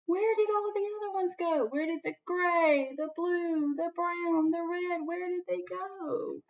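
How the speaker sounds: high, puzzled searching voice; bewildered peddler. Acted. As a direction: wonder aloud where they went. Peddler finds his caps gone.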